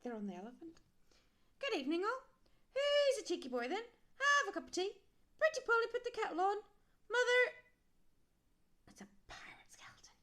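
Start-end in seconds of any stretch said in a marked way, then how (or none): 1.6-7.5 very high, loud squawking parrot. Acted. Pirate skeleton's parrot greets them, high and loud. 8.9-10.1 hushed whisper. Real, not acted. Reader whispers to the child about the pirate.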